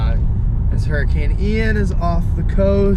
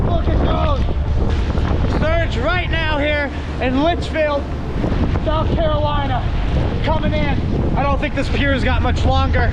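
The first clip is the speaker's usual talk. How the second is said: excited; 7 semitones higher than usual. Real, not acted. Filming storm surge at pier.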